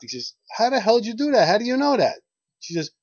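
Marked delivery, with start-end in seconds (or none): 0.5-2.2 high, loud shocked yelp. Acted. Birthday man stunned that the old lady guessed his age, high shocked shout. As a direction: shoot the voice way up in disbelief. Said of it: "He was shocked".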